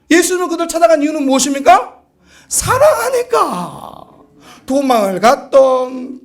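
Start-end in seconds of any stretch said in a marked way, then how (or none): 2.5-4.0 drawn-out answer. Real, not acted. Answers his own question emphatically.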